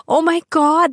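ordinary talk

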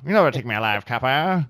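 put-on old gangster movie tough guy; hammed-up old-time mobster; 1920s villain voice. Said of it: "the nineteen twenties villain voice".

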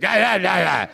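high, loud string of mock protest syllables; mimicked chef's tone. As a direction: parody the chef arguing, tone without words. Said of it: "the tone I heard".

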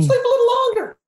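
very high-pitched voice of the mind; tempting inner voice. Acted. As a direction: coax in a tiny, high put-on voice. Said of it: "my mind will go".

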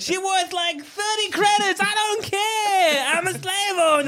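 high squeaky alien slaver; high Watto voice. Retold or imitated. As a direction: shout it like a callous alien. S1 voicing Watto, high and far.

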